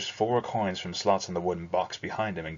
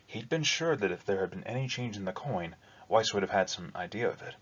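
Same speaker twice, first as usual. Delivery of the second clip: ordinary delivery, nothing marked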